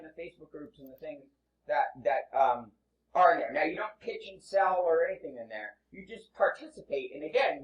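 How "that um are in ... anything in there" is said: normal talking voice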